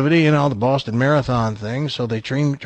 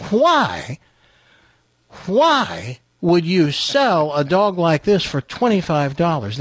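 drawn-out, higher-pitched acted bafflement; incredulous buyer; 5 semitones higher, slower than usual. Buyer questions owner about cheap dog, acted incredulity. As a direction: stretch out each why in disbelief. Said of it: "guy looks at the dog's owner and says".

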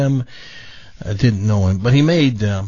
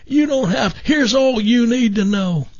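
put-on higher raspy voice with a little southern Missouri accent; Jim McMillan impression; 8 semitones higher, slower than usual. Jim praising Frank's impression, in raspy southern voice. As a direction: chuckle through it like a friendly garden-show host. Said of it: "kind of a raspy voice, a little southern accent".

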